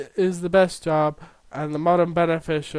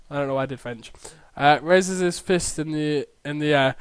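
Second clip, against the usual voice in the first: ordinary talk, nothing marked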